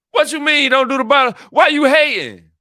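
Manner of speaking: high-pitched, loud indignant protest; hammed-up outrage at the haters; mad. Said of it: "I get mad".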